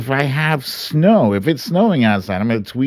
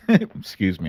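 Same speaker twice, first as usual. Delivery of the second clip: normal talking voice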